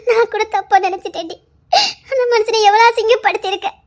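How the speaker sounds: usual speaking voice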